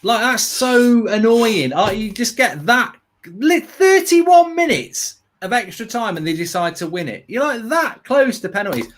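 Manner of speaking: real exasperation. Real, not acted. Fan annoyed by winner so close to penalties, real exasperation.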